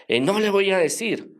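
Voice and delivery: retold protest, pitched well up; defiant refusal. Possessed woman refusing the praying seminarian, high and defiant.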